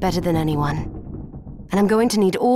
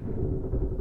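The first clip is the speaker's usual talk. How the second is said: weary sigh; 8 dB quieter than usual. Acted. Weary sigh before reflecting.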